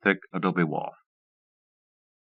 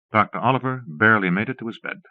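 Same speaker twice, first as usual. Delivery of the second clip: usual speaking voice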